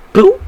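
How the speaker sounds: sudden loud, high boop; sharp, loud vocal sound effect; imitated impact noise. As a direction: pop out a short cartoon hit sound. Imitates the crowbar blow.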